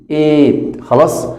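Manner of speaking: ordinary talk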